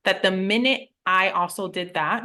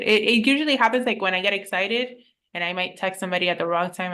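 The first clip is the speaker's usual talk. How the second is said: ordinary delivery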